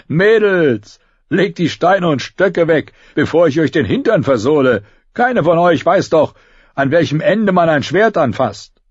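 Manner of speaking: high, jeering character voice; mock them as harmless children; amused taunting. A narrator's reading. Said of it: "hielt das Ganze noch immer für Spaß".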